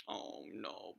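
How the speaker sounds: breathy little oh no; whispered pity; soft whisper. Pretend. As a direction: sympathise under your breath. Storyteller confides to children.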